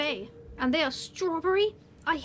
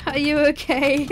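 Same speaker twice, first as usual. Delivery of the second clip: louder, worried check-in; concerned call; 2 semitones higher, 8 dB louder than usual. Acted. Worker calling to a clumsy coworker, louder and concerned. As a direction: call out to see if they're hurt.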